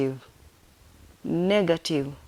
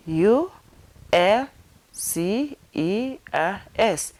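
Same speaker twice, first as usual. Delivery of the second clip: spelled out; much slower than usual. Shown as a demonstration. Teacher finger-spelling ulcers for learners, spelled out. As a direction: separate letters with long pauses. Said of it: "Let me come again".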